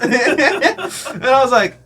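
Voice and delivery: ordinary delivery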